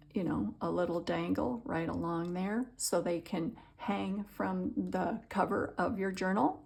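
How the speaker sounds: ordinary talk, nothing marked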